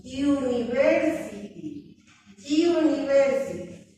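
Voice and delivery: "university" spoken slowly, starting with a y sound, yu-ni-ver-si-ty; pronouncing university. Shown as a demonstration. Teacher showing how "university" really sounds to students. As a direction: stretch out "university" with the initial y. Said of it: "pronuncio, digo".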